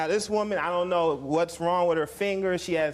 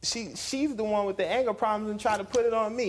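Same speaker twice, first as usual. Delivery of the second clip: usual speaking voice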